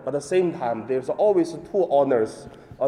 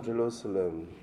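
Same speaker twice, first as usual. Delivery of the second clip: soft lament; 4 semitones lower, 6 dB quieter than usual. Acted. Lament, repeated name.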